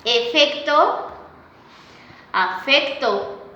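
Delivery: "Efecto" and "afecto" each spoken slowly and separately; slow careful contrast. Shown as a demonstration. Teacher to class, contrasting paronyms slowly. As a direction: draw out each word so the class hears the one vowel that differs. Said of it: "Por ejemplo".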